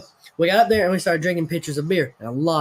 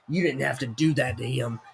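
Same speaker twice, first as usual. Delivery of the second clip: put-on low, soft muttered scold from an old man; a hint of a disapproving old man; lightly voiced old man; 6 semitones lower, 5 dB quieter than usual. Old man at the bar scolding the storyteller, low and quiet.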